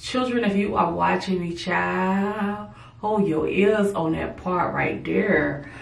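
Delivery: ordinary talk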